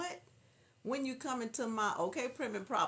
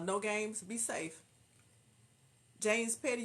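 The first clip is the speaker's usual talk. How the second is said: ordinary delivery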